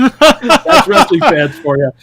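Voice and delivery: slow, loud and laughing; can barely get it out for laughing; laughing at the friend's story. Real, not acted.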